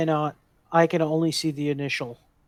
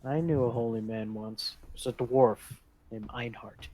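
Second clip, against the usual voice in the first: soft, lower recollection; hushed in-character reply; 4 semitones lower, 9 dB quieter than usual. Acted. Adventurer answering the holy man, hushed. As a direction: keep it low and measured.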